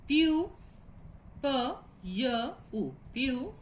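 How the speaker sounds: "pu" broken into p, y, u; isolated sounds "p, y, u" rejoined as "pu"; sounding out. Shown as a demonstration. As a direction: separate each sound, then blend "pu". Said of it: "and then syllable".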